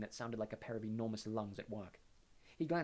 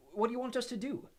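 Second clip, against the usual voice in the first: put-on eager teenage boy; earnest schoolboy offering help; young boy voice; 12 semitones higher, 6 dB louder than usual. A narrator's reading. Harry voice.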